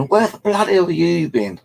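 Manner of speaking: high-pitched retold yell; imitating angry parents yelling; parents shouting. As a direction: shout it at your late son, all alarm. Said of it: "they, they shouted at me".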